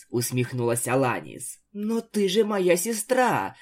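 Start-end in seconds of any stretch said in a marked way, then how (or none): 1.7-3.5 put-on higher, slow, wounded little girl; a small sister stung by the answer; hurt surprise. A narrator's reading. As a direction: stretch it out like a hurt child. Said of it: "удивилась Изабель".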